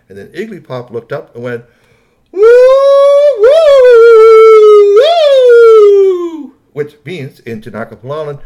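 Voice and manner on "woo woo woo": long, high, loud whoops; alien whooping. A narrator's reading. Stranded Iggy Pop calls to the ships, loud alien whooping.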